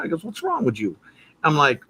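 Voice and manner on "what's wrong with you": high-pitched mock disbelief; put-on high voice, challenging; imitating a friend. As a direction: mimic the pal, baffled and high. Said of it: "that's how he talked".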